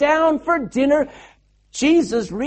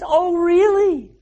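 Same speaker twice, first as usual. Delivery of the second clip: slow, higher-pitched feigned surprise; mock surprise; 6 semitones higher, slower than usual. Jesus answering the disciples' news, playfully feigned surprise. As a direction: draw it out as if you had no idea.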